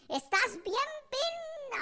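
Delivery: high, drawn-out mock scolding; mock cursing mother. Mother insulting her child, high mock scolding. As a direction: stretch the half-censored curse up high. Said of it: "toda su colección esa de maldiciones".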